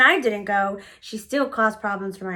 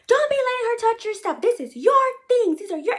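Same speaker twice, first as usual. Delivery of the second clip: much higher, sharp scolding; mock shrill yell; 12 semitones higher than usual. Baby mama scolding the girl over her notebook, with a high shrill yell. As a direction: shriek it like a furious mother. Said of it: "she's yelling at the little girl".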